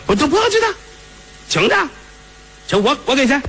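put-on spoiled young master. Young master brushing off the madam, high and carefree. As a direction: toss it off like money means nothing.

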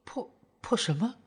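soft, halting puzzled question. A narrator's reading. Girlfriend baffled by the father's word, puzzled. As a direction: stumble softly on the odd word. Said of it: "不解地看着赵正义".

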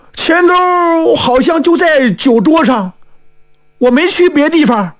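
high, anxious voice; fretful, uncertain recollection; worried. Acted. Newcomer recalls the purse on the table, worried. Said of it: "这个人是惊慌万分".

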